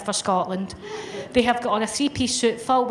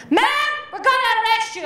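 shouting agent; 11 semitones higher than usual. Retold or imitated. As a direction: yell it like a jumpy federal agent. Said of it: "I don't know why you're shouting".

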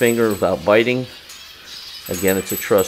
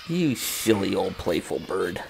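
soft cooing; 7 dB quieter, slower than usual. Real, not acted. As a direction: coo it gently to the bird. Cooing to the parrot.

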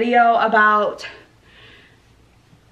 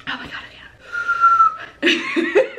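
laughing; much slower than usual. Real, not acted. Spicy challenge reaction.

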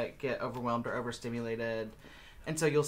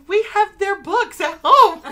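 much higher and louder, laughing; excited realization; 14 dB louder, slower than usual. Retold or imitated. Imitating Jeremy realizing they own the books, laughing squeal. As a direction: gasp it out as the penny drops.

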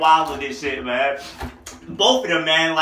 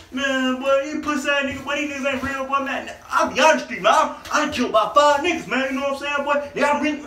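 higher, shouted fake-hard voice; mock street tough; 7 semitones higher than usual. Mocking fake street guys who brag about their bodies, in a high shout. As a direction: puff up like a wannabe gangster bragging.

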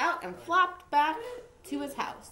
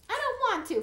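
put-on high-pitched childish whine; acted whiny refusal; whining; 12 semitones higher, much faster than usual. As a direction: whine it like a reluctant little frog. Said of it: "Froggy whined".

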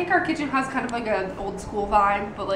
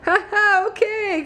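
high, louder fake polite laugh; imitating baffled interviewer; 9 semitones higher, 3 dB louder, slower than usual. Interviewer answering a boy's unrelated remark, imitated fake laugh. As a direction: humour the kid with a put-on "ha ha, okay". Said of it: "And she's like".

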